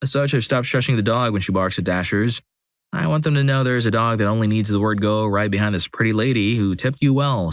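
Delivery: ordinary delivery, nothing marked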